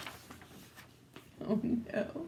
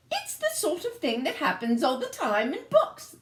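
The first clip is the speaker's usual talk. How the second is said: higher, lightly voiced assurance; the royals reassure the prince, higher; reassuring royals; 7 semitones higher than usual. A narrator's reading. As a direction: assure the prince it always works. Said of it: "they assured him".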